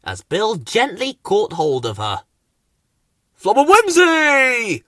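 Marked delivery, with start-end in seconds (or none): 3.4-4.8 high, loud, drawn-out holler; silly triumphant squeaky shout; calling out. A narrator's reading. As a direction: call with a long final vowel. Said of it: "called Bill to Ben".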